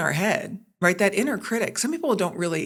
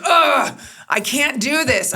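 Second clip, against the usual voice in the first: much higher, louder whiny ugh; frustrated homework groan; 8 semitones higher, 5 dB louder, slower than usual. Retold or imitated. Daughter groaning over her homework, whiny and frustrated. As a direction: groan it like a fed-up kid. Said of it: "she goes".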